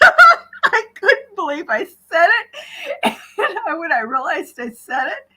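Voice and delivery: high, slow words through real laughter; can barely get the words out for laughing; laughing helplessly. Real, not acted. Recalling the car ride, still laughing hard.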